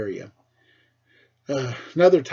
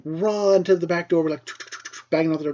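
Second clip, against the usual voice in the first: ordinary talk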